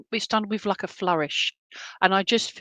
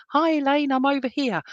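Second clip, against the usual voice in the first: high greeting called across; calling from afar; 6 semitones higher than usual. Retold or imitated. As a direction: hail her from a chair further back. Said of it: "sit back in my comfy chair and say".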